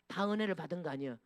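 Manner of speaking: ordinary delivery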